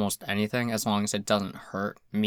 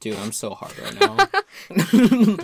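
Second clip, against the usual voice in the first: laughing joke; 9 semitones higher, much slower than usual. Pretend. Joking reply, slow, laughing.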